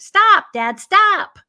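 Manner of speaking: loud, high shout to stop; child's urgent cry, retold; retold desperate cry. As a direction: plead loudly with Dad, pitch leaping up. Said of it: "I just stood there and went".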